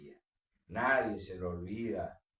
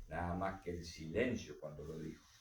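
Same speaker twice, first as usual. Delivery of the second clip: ordinary delivery, nothing marked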